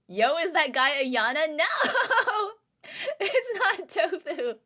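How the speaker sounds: loud, high laughing outburst; laughing shock. Real, not acted. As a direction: cry out in real disbelief through laughter. Reacting with shock to the game.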